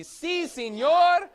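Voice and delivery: si, senor called high and loud in a Spanish accent. Shown as a demonstration. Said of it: "a good Spanish accent".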